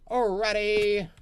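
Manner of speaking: drawn-out alrighty. Real, not acted.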